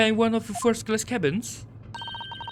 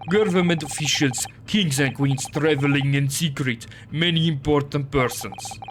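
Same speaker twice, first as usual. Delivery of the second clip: gruff Russian sailor, proud; broken English with a heavy Russian accent; 6 semitones lower than usual. Acted.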